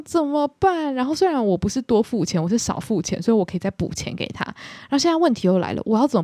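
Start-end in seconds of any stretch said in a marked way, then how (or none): 0.0-0.9 high flustered plea, thrown off balance; stuck on the shopping app, flustered high plea; panicked. Retold or imitated. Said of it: "然后我就是吓到语无伦次".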